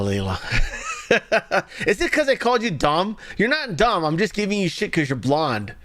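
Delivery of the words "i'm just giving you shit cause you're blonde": normal talking voice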